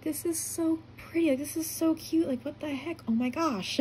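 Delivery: high, delighted raving; gushing praise. Retold or imitated. Actress raving over a fan's postcard design, gushing. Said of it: "raving over the postcard".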